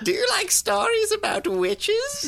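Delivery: very high cackling witch; witchy host asking kids about witches. Acted. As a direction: screech it like a witch. Spooky host voice.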